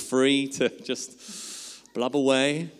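chuckling through the words; real laugh mid-line. Real, not acted. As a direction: laugh as you admit it. Laughing about crying at TV.

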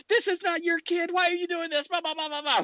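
high, mocking nagging voice; mocking parent. Annoyed parent tells off a nosy bystander, high mocking nag. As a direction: parody an indignant parent brushing you off.

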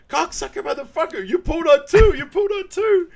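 high, very loud mock fury; shrieked mock protest; imitated outraged yell. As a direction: scream the accusation in comic pain. Acting out outrage at early pull.

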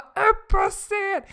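high, slow, loud call; insistent, stretched shout; calling on stage. Retold or imitated. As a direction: draw out the command. Organisers insist again.

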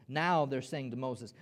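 normal talking voice

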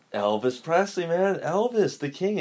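high-pitched mock disbelief, retelling a fan; freaking out. Dad rocker to woman who doesn't know Elvis, mock freaking out. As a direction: imitate an exasperated fan, pitch climbing. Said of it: "he was just freaking out".

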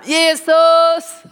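shouting Jesus in panic during a car crash; desperate shout. Retold or imitated. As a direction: yell it out as the car spins toward the trucks. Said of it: "då skrek vi båda det namn".

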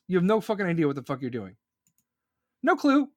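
ordinary talk

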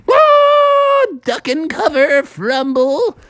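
high mock scream; shriek, then call out to take cover; hammed-up cartoon alarm; panicked warning. Characters scream before the crash.

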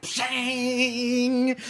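mock gong crash; gong sound. The DM voices Spazlatrash's little gong as a mock crash. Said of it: "he's like".

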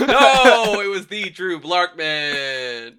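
loud, high, drawn-out no, easing down; hammed-up wail, stretched slow; mock outcry. Correcting cohosts' wrong guess, hammed-up mock outcry.

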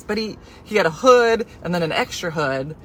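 normal talking voice

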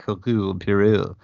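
lightly hammed-up French accent on the name; French name in a clumsy mock French accent; awful French accent. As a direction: have a bad go at a French accent. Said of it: "My awful French accent".

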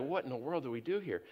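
quiet, bewildered murmur; hushed aside. Retold or imitated. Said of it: "we are like".

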